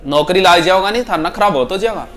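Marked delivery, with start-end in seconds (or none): none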